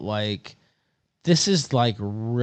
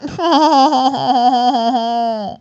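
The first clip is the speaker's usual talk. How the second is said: laughing; 10 semitones higher, 4 dB louder than usual. Real, not acted. Mocking laugh after parody.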